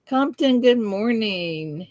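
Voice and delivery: ordinary delivery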